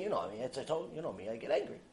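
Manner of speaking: low, quiet, offhand excuse; mock nonchalant self-excuse; acted shrugging excuse. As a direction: toss it off softly, low in the voice. Excusing one's own temper, acted.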